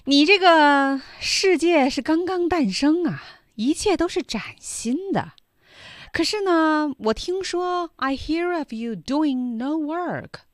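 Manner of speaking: a lightly voiced scolding djinn. Teacher voices djinn in Chinese.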